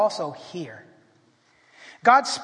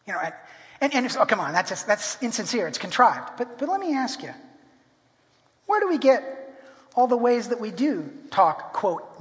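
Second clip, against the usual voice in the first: ordinary talk, nothing marked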